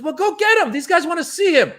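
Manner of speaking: very high, exasperated mock order; urgent squeal of an order; retold urgent demand. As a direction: imitate a frantic host sending someone off. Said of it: "He goes".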